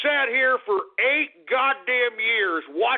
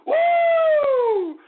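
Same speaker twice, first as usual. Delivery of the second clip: high, loud whoop; celebratory shout; victory whoop; 5 dB louder than usual. Real, not acted. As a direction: whoop like a fan at a game. Celebrating election win.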